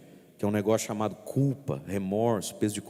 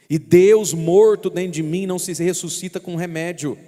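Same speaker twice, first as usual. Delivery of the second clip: louder, higher impassioned sermon; fiery preaching; 7 semitones higher, 6 dB louder than usual. Acted. Priest preaching to the congregation, impassioned. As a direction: preach it with full force.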